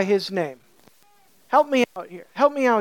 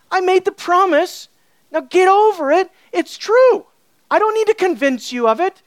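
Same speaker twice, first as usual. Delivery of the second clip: loud, high-pitched exasperated insistence; high, forceful mock dismissal; impatient retort; 8 semitones higher, 6 dB louder than usual. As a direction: act fed up with being doubted. Voices an impatient God, hypothetical retort.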